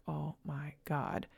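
soft, slow dread. Retold or imitated. Reading a client's text, soft slow dread. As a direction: barely breathe it out, slow. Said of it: "And I'd be like".

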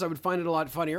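ordinary delivery

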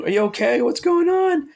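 high-pitched puzzled questioning; mock concern. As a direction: act out a wife checking on a yelling husband. Acting out his alarmed wife.

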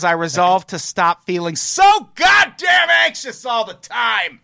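high, loud, anxious shouting; reading in all caps. Acted. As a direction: yell it like it's in caps. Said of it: "it's all in caps".

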